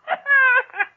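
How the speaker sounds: high crazed cackle; old Sadie cackling over dropping her son; mad cackle. Acted. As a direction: cackle with glee.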